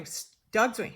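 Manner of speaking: ordinary delivery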